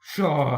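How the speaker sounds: loud dismissive puff; scornful pshaw. A narrator's reading. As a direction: blow it out in contempt. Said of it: "said Gordon".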